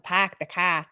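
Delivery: confident Boston accent, mouth wide open; Boston vowels with the corners of the mouth up. Shown as a demonstration. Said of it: "put the corners up to say Boston accent".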